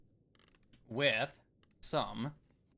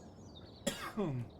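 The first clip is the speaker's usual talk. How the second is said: coughing up water; 4 semitones higher, 7 dB quieter than usual. Real, not acted. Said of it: "went down the wrong pipe".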